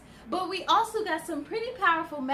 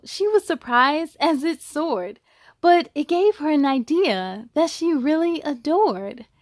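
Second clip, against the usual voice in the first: rhyming storybook read with a steady lilt; picture-book narration; reading to children; 3 semitones higher, 8 dB louder than usual. A narrator's reading. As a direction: read it to a class of kids. Reads a picture book aloud.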